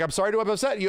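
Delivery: mock-contrite assistant; lightly voiced sorry robot. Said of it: "They're like".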